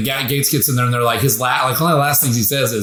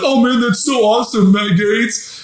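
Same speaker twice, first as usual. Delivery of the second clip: mock gushing; 7 semitones higher than usual. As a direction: gush over him like a fawning fan. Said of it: "They were like".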